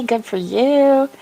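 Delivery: slow sing-song praise; pleased for her, drawn out; slow sing-song approval; cheery praise. Real, not acted. Cheering viewer coffee.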